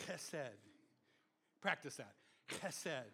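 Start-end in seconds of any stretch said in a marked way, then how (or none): 0.1-0.6 chesed with a throaty ch at the start; guttural Hebrew ch. Shown as a demonstration. Pastor teaching the congregation Hebrew, throaty chesed. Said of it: "You gotta say it with that". 2.5-3.0 Chesed with a throaty ch at the start; guttural Hebrew ch. Shown as a demonstration. Pastor drilling the congregation on Hebrew, throaty Chesed. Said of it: "a little more guttural sound".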